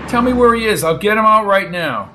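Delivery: loud, high-pitched insistence; urgent demand. Acted. Brady vowing to rescue Bob, urgent and loud. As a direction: demand it, ready for action.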